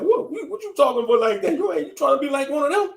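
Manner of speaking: high, put-on grandparent voice; mock scolding elder; mocking Canadian grandparent. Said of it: "Shit, be like".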